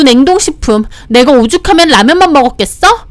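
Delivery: high, loud, piercing list of grievances; shrill, ringing tirade. Acted. Sister complaining to her brother about room and food, shrill. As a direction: rail at him in a high, indignant voice. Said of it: "신우는 카랑카랑하게 따져대고".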